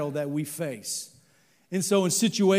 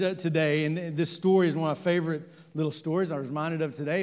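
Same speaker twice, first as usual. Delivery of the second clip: ordinary talk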